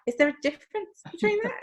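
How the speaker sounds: high, fast, innocent "Is there a difference"; naive question. Retold or imitated. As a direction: ask it like you truly don't know. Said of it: "that I was like".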